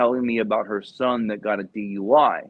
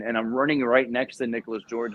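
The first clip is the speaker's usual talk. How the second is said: ordinary talk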